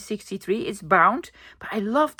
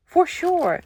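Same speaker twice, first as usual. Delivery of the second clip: higher playful echo; 8 semitones higher than usual. Real, not acted. Echoing her own price joke to viewers, playful and high. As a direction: repeat it higher in a sing-song way. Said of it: "more than a euro for sure".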